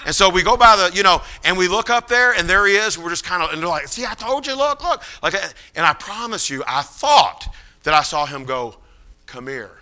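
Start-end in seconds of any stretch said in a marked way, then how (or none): 3.9-5.0 urgent I-told-you-so, pitched way up; excited companions. Retold or imitated. Companions excitedly pointing him out. 9.3-9.8 low, very soft summons; barely voiced call to come over; hushed beckoning. Retold distant beckoning, imagined.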